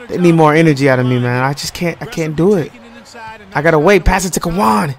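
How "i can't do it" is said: ordinary talk, nothing marked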